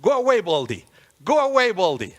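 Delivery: mild bullies' taunt, repeated; lightly acted jeering yell; mocking yell. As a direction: call it out like a jeering bully. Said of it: "bullies who started to yell at him".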